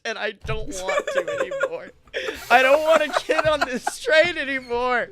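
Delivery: high, loud laughing wail; laughing despair. Real, not acted. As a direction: laugh while pleading to stop. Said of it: "I keep going back to the Ugandan accent".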